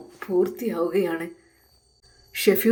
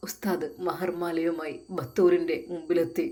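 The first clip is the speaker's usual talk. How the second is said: plain reading voice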